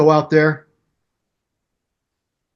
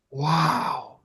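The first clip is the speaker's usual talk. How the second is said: retold moment of quiet amazement; hushed awe; 8 dB quieter than usual. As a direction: let it sink in quietly. Retold awed reaction to remark.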